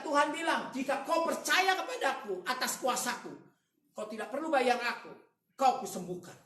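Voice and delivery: loud, high, driving sermon delivery; full-force preaching. Real, not acted. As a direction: preach it hard to the whole congregation. (khotbah lantang berapi-api)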